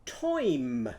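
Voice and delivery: "Toim" said as one clear syllable; single-syllable "Toim" demo; oi in yam. Shown as a demonstration. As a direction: swap the vowel of yam for oi. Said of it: "what's the yam going to be?".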